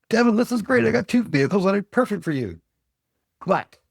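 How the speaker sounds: oversell it, all eager; excited salesman. Retold or imitated. Salesman to a customer about two perfect SUVs, high and excited. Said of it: "and he says".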